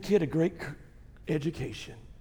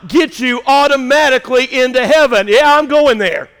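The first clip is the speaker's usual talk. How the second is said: high, loud pulpit delivery; forceful high-pitched sermon voice; full-force preaching; 8 semitones higher, 12 dB louder than usual. Real, not acted. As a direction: preach it out to the whole room, building to a shout.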